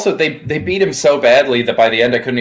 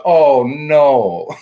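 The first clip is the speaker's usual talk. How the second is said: mild mock cry of dismay, higher; retold yell; 4 semitones higher, 4 dB louder than usual. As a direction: re-enact yelling out in dismay, drawn out. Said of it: "I yelled out".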